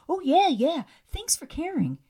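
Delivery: high-pitched mocking imitation; mock gratitude. Mocking Louis's reply to Andy.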